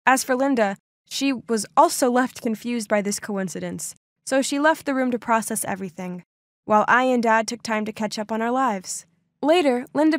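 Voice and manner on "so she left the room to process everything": ordinary delivery, nothing marked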